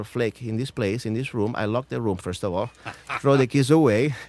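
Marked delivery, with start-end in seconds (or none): 2.9-4.1 louder, laughing through the words; amused, laugh-filled punchline. Real, not acted. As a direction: joke it out with a real laugh. Jokes about locking senator in.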